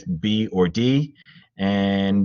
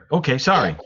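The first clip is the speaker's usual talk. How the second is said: normal talking voice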